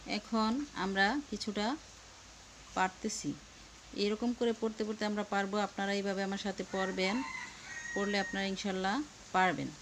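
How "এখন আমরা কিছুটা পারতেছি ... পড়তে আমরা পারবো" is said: normal talking voice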